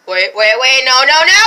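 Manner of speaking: loud frantic protest; panicked shout. Acted. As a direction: yell to stop it. Panicking as something happens.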